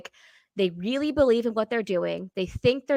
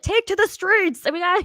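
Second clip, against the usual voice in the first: hammed-up Gryffindor activist, pitched up; mock activist rallying; 10 semitones higher, 4 dB louder than usual. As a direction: imitate a street protester's call to action. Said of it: "they're the ones who are like".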